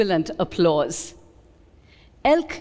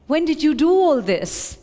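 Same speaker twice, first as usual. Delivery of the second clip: high, louder astonished question; amazed; 7 semitones higher, 4 dB louder than usual. A narrator's reading. Nissim stunned by Elk's twenty-five works, higher and louder. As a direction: marvel at the stack of work.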